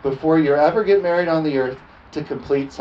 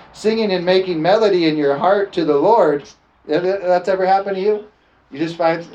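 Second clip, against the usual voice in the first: ordinary talk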